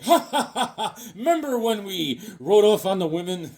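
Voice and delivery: put-on guffaw running into words; hammed-up laughing reminiscence. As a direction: laugh heartily, then reminisce with buddies. Voices Tarzan laughing with jungle buddies.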